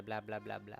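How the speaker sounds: ordinary talk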